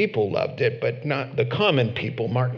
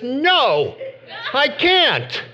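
much higher, louder, laughing mock refusal; mocking nominee; 10 semitones higher, 4 dB louder, much slower than usual. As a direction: squeak it out like a caricature. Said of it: "And she says".

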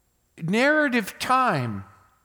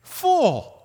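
a sharp, raised cry of Fool from God; God's rebuke; 6 semitones higher than usual. Acted. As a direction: rebuke him as God would, sharp and brief. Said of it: "But God said to him".

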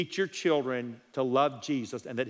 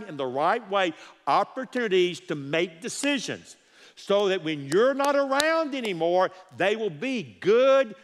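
preaching crescendo; 4 semitones higher than usual. Real, not acted. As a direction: drive it home, rising with each phrase. Preaching builds to climax.

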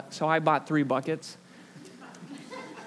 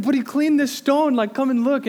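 higher, louder excited husband; husband eagerly showing wife the stone, higher and louder; eager pleading; 10 semitones higher, 8 dB louder than usual. Retold or imitated. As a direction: plead it like a kid. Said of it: "And I said".

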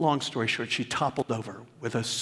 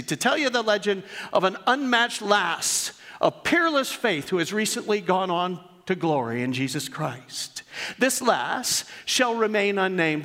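reading aloud; 6 semitones higher than usual. Acted. Pastor reading his daughter's tribute to the church, raised storytelling read. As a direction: read it like a storyteller opening a tale.

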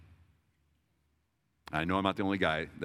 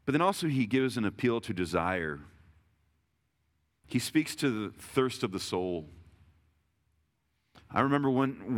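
usual speaking voice

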